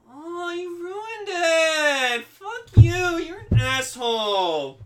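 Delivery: high, drawn-out wail bursting into a shout; hammed-up tantrum. Shown as a demonstration. Showing how the upstaged villain should sound, hammed-up tantrum. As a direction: wail it, then yell the insults. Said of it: "it's supposed to be like this".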